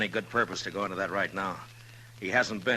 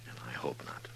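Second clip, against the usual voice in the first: whisper; 4 semitones lower, 9 dB quieter than usual. Acted. Waiting in ambush, hushed.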